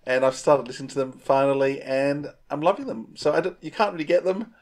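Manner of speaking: ordinary talk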